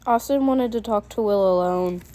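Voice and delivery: ordinary delivery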